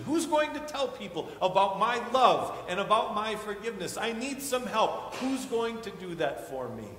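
high and loud, a pleading call for help; voice of God pleading. Acted. God calling out to his children for help, pleading and loud. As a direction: cry out as God asking for a helper. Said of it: "Our God says".

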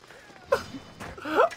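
breathless squeaky laugh; helpless high-pitched giggle. Real, not acted. Cracking up at the chase.

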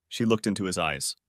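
ordinary delivery

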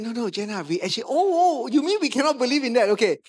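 high, loud and fast, tripping over himself; flustered backpedal. Retold or imitated. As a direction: catch yourself mid-protest in a fluster. Pastor protesting, then backpedaling.

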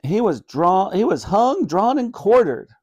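put-on high-pitched, eager boy's guess; excited. A narrator's reading. Ben guessing a grisly punishment, excitedly. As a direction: blurt the answer out eagerly. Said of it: "asked Ben, excitedly".

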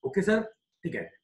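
ordinary talk, nothing marked

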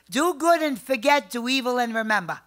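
proclaiming the family saying to the audience. Real, not acted. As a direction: announce it like a motto.

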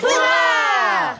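loud joyful shout; excited cheer. Acted. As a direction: cheer at the top of your voice. Cheering the rescue.

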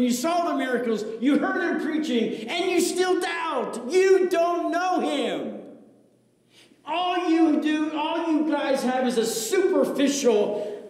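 preacher voicing a scholar berating his students; scholar's rebuke. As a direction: scold them like a stern rabbi.

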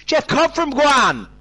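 high, drawn-out shout. Shown as a demonstration. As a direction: belt it out, almost too loud. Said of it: "you have to say it a lot louder".

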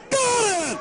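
sudden high yelp of joy; excited. Real, not acted. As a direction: jump up as the shot drops. Big three pointer goes in.

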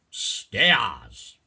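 loud, drawn-out Cockney; Cockney accent. Shown as a demonstration. Teacher translating apples and pears, loud Cockney. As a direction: belt the word in broad Cockney. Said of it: "I'll do the first bit in Cockney".

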